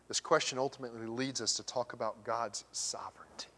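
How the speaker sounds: a dramatic whisper before the repeat. Acted. Preacher hushing the congregation for emphasis, whispered.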